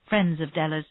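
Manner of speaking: ordinary delivery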